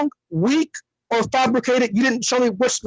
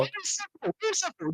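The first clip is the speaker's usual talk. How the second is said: mock high voice; 10 semitones higher, 8 dB quieter than usual. Caller mocking in high voice.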